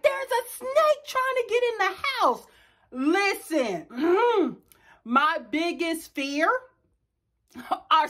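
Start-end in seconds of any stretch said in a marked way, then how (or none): 0.0-2.5 much higher, alarmed report; scared daughter. Retold or imitated. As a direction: blurt it out in a fright. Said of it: "She's like".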